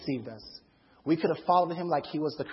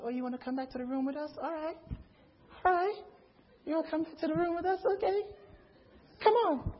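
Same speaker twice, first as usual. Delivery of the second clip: soft, quick, high mock-coy voice; coy invitation; 10 semitones higher, 6 dB quieter than usual. Men to girls after the party, coyly agreeing. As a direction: play it sly and softly eager.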